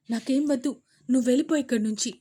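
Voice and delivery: loud, fast brush-off; sulky rejection. Acted. Daughter sending mother away, sulky and loud. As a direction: push her away.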